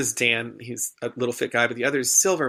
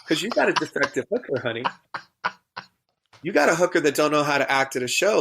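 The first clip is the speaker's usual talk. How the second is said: higher, sassy drag-queen scolding; sassy telling-off; 4 semitones higher than usual. Retold or imitated. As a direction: read him like a diva dismissing a fool. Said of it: "I was like".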